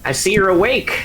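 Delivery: high nasal voice; pinched skull creature voice; nasally skull voice. Acted.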